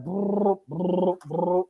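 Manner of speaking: soft rolling brrr of fast punches; rapid punch noises. Retold or imitated. Boxer telling a friend about his final round, rolled brrr sounds. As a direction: mimic a flurry of punches with your lips.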